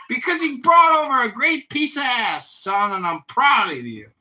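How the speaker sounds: high, loud mock dad voice; imitating his dad. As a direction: ham up the proud father, loud and high. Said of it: "He'll be like".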